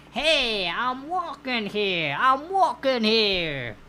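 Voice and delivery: put-on loud, drawn-out New York accent shout; New York accent yell. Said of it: "In a New Yorkian styled accent".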